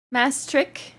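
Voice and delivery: ordinary talk, nothing marked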